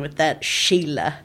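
weak Australian accent attempt; a hint of Australian on Sheila. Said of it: "I can't do an Australian accent".

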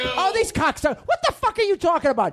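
high, exasperated tirade; angry rant. Acted. Bandleader berating his band, high angry rant.

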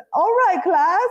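mock British lecturer, pitched well up; put-on British accent. Cohost imitates Ross returning to teaching, high fake British accent. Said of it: "Like, goes back to his accent".

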